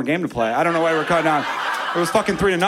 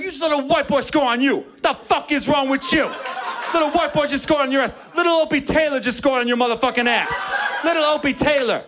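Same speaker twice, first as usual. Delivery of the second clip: mocking trash talk; 10 semitones higher than usual. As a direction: tear into your own teammate, laughing. Other team scolds their man.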